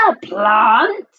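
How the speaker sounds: understated appalled exclamation; horrified yell. A narrator's reading. Mum hearing he would eat a plant, horrified. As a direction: query it in mild mock horror. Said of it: "yelled Mum in horror".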